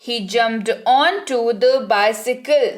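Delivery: slow, careful model sentence for students; slow dictation. Shown as a demonstration. Teacher dictating to students, slow and careful. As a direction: say each word slowly and clearly. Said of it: "teacher models English sentence".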